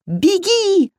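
very high, drawn-out scream; high-pitched urgent shriek. A narrator's reading. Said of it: "завопила Васька".

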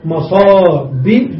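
slow, drawn-out مصابيح, stretching the long i; drawn-out plural. Shown as a demonstration. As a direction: draw out مصابيح so the ya is clear. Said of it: "في الجمع".

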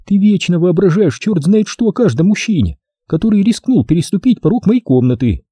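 strained, exasperated sister; a sister fed up with her brother's suspicion; rising tension. A narrator's reading. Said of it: "Напряжение в её голосе возросло".

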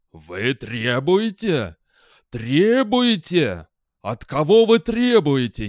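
loud, high-pitched wrathful rebuke, drawn-out opening words; incredulous fury, building into a loud tirade; angry exclamation. A narrator's reading. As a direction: erupt at his insolence, stretching the questions. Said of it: "воскликнул гневно".

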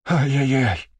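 high wailing ay-yay-yay; lamenting wail. Acted. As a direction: wail it like a fretting nurse. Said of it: "Очень по-человечески запричитал зверолюд".

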